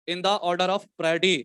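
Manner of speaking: slow, drawn-out dictation with long pauses. Acted. Teacher dictating a JEE question to the class, slow and drawn out. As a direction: read each phrase slowly so students can write it down.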